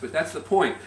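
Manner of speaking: normal talking voice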